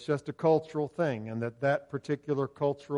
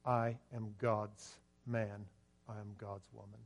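slow, dropping to very soft; solemn vow; 4 semitones lower, 10 dB quieter than usual. Acted. Preacher models a vow for the congregation, slow and hushed. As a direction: let the vow sink lower and quieter.

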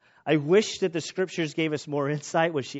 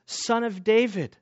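reverent address; 6 semitones higher than usual. Retold or imitated. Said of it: "and she says".

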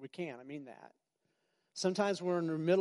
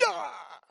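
mock outburst; 10 semitones higher than usual. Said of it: "you're kinda like".

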